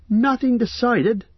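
high, slow astonished cry; crying out in disbelief. Acted. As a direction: rise up in shock at the news. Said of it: "cried Pippin".

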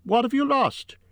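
calling out. A narrator's reading. Bilbo asks wailing Gollum, calling out. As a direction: call the question across the gloom. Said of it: "Bilbo called".

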